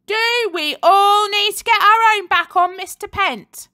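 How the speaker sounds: high, loud rabble-rousing call; rousing cry. A narrator's reading. Girl on the climbing frame rouses her classmates, loud. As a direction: fire up the crowd.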